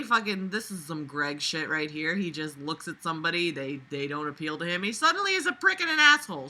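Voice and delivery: normal talking voice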